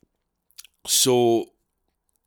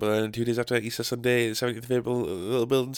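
fast, breathless gig plug; hyped-up ad for his headline show; rushed promo read; 2 semitones higher, 3 dB quieter, much faster than usual. Acted. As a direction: rattle through the dates quickly. Second promo over music.

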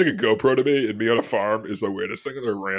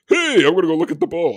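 higher, louder cheery-hello call; 7 semitones higher, 8 dB louder than usual. Retold or imitated. Farm visitor announcing a look at the bull, calling out. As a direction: sing out an eager announcement, retold.

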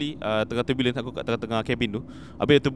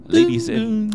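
flight attendant announcement; 9 semitones higher, 5 dB louder, much slower than usual. Shown as a demonstration. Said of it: "maybe aku boleh imbas balik".

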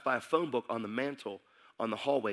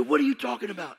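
angry retort; 9 semitones higher, 7 dB louder than usual. Retold or imitated. Arguing with pastor.

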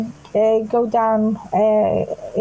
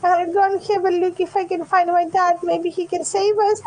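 put-on higher, pleading child voice; lost little girl; 7 semitones higher than usual. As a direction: play a scared kid who lost her dad. Opal child voice.

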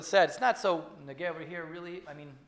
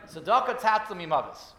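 the verse said again, louder and firm; firm insistent repeat; 8 dB louder than usual. Retold or imitated. The rabbi repeats the verse to the dying man, louder and insistent. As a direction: press the verse home with weight.

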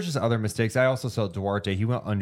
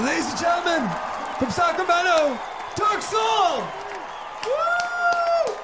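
very high, loud hype shout; concert announcer; 3 dB louder, slower than usual. Pretend. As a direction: roar it to a stadium crowd. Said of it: "like we're at a stadium".

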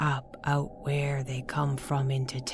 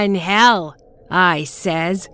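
gloating shout; 4 semitones higher, 9 dB louder, slower than usual. Acted. Crone gloats after cackle.

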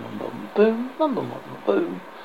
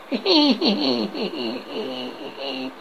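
high wheezy cackle; old man laughing at the knight, cackling; old man's cackle; 5 semitones higher, 6 dB quieter than usual. Acted. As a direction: cackle like a mad old hermit.

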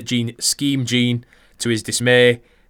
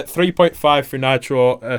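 ordinary talk, nothing marked

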